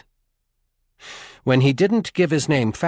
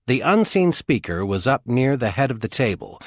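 usual speaking voice

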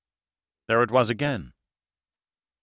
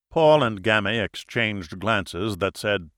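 ordinary delivery, nothing marked

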